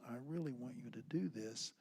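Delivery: quiet, controlled request; soft command. Shown as a demonstration. Dad showing his softened way of commanding kids, soft voice. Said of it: "softening my voice and giving a command".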